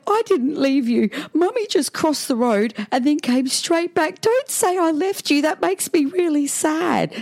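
high, slow fake-tearful pleading; hammed-up weepy mum, drawn out; pretend crying. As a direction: whimper to guilt your child. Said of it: "I started to pretend I'm crying".

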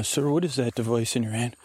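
ordinary talk

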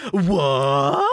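loud, drawn-out comic wail; hammed-up outraged yelp; mock shocked cry. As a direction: blurt it out in exaggerated disbelief. Acting out Hana's shock.

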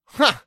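sharp loud high huh; loud huh laugh. Shown as a demonstration. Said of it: "Perhaps even a".

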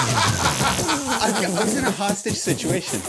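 breaks into a high laugh over the price; laughing in disbelief at the fare. Real, not acted. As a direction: laugh it out, incredulous. Laughing at ten bucks.

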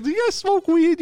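high-pitched retold question; imitated tow driver. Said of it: "but he was like".

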